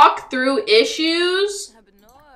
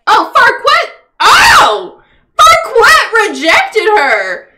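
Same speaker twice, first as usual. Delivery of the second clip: higher, louder stunned outcry, slow at the end; shocked shout; 8 semitones higher, 7 dB louder, slower than usual. Real, not acted. Streamer reacting to Farquaad rejecting Red, shouting in shock. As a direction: cry out in disbelief at the news.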